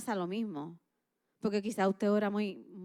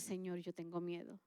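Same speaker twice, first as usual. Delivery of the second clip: soft honest prayer; 5 semitones lower, 12 dB quieter than usual. Pretend.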